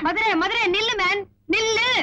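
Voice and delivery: high-pitched urgent call to stop; calling after someone. Acted. Woman calls after Madurai as he leaves, high urgent call.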